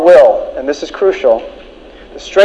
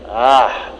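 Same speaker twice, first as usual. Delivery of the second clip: senior devil; 5 semitones lower than usual. Acted. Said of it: "said the senior devil".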